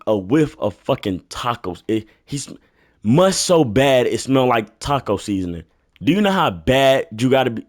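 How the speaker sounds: normal talking voice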